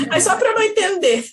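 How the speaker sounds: high, loud words through real laughter; giggling while joking; laughing. Real, not acted. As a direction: laugh it out as you say it. Joking about mother's jealousy.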